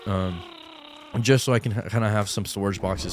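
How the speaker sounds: usual speaking voice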